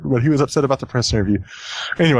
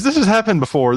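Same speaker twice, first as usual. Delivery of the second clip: ordinary delivery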